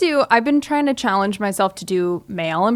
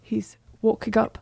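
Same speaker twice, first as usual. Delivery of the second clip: Michael Caine impression; 3 dB quieter than usual. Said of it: "Michael Caine".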